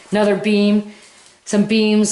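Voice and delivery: throat-cleared hoarse voice; talking with a swollen sore throat; swollen tonsils voice. Real, not acted. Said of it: "My throat is so swollen right now".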